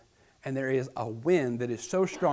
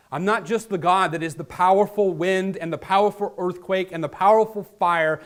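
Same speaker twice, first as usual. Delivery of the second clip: louder, proclaiming power; mighty God voice; 4 semitones higher, 8 dB louder than usual. Acted. God declaring his power to Elijah, louder. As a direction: boom out the might. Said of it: "God first speaks to Elijah".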